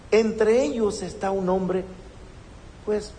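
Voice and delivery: normal talking voice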